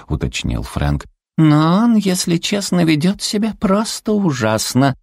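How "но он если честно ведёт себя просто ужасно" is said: very high, put-out cutesy voice; indignant little creature. A narrator's reading. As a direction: complain in a tiny high voice. Зверёк жалуется на маршала. (обиженный писклявый голосок зверька)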